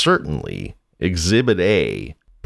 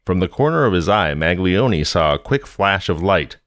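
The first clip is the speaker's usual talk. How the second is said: ordinary delivery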